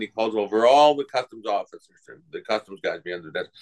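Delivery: ordinary talk, nothing marked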